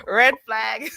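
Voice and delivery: high laugh around "Red flag". Real, not acted. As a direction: laugh it off in disbelief. Laughing at caregiver claim.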